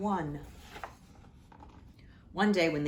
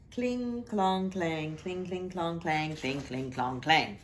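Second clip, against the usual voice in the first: sing-song clanging knight noises; playful metallic cling clong sounds; clanking armor. Acted. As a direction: ring out each clang like armor clattering.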